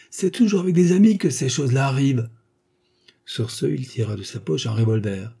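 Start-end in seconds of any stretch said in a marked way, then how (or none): 0.1-2.3 higher-pitched angry outburst; yelling. A narrator's reading. Husband shakes the supposed lover, yelling bitterly. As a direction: rant in loud indignation. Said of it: "hurla-t-il".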